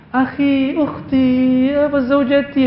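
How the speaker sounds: lightly mocking forced sweetness; a hint of a fake pious couple; affected sweet talk. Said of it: "Itu dibuat-buat namanya".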